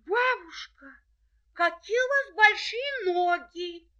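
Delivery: put-on high, loud amazed girl; wide-eyed little girl. A narrator's reading. Girl asking granny.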